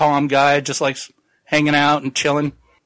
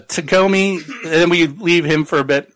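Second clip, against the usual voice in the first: normal talking voice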